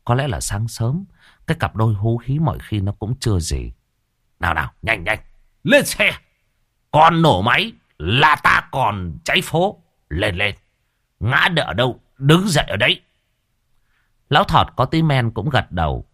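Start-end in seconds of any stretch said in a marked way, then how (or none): 4.4-13.0 put-on tipsy, rowdy old man egging his mates on; slurred, boisterous drunk cheering. Drunk old man urging friends on.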